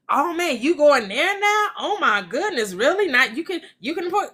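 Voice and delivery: high, surprised gushing friend; amazed friend. Acted. Impressed friend reacts to her new spending, high and amazed.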